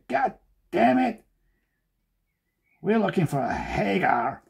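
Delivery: high, slow put-on American opener; hammed-up American caller, sliding back toward normal; mock American accent. Imagined King Features caller ringing him up, mock American accent. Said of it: "I can't really do an American one".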